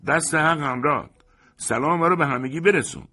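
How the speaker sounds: high, loud, gloating send-off; triumphant call. A narrator's reading. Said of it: "پیروزمندانه بانگ زد".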